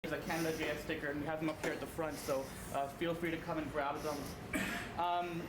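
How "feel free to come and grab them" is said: usual speaking voice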